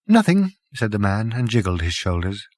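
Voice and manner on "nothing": high, offhand denial; casual dismissive. Acted. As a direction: brush it off lightly. Prack answers lazily.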